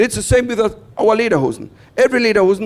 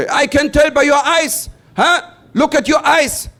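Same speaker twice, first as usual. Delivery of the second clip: officer accusation; 8 semitones higher than usual. Retold or imitated. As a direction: press him like a suspicious border guard. Officer voice continues.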